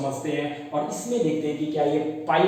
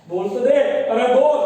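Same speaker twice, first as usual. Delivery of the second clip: louder, higher push to answer; urging shout; 5 semitones higher, 6 dB louder than usual. Real, not acted. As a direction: bark at the class to speak up. Teacher urging silent students.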